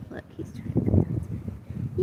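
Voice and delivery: soft breathy whisper to the camera; whispered aside. Real, not acted. Telling viewers the bird wants to talk, whispered. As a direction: whisper so you don't startle the bird.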